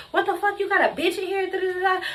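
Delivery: very high-pitched mock indignant woman; retold quarrel. Pregnant woman confronting girl in room, retold quarrel. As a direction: imitate her outburst high, without shouting.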